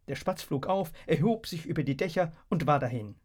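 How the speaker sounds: plain reading voice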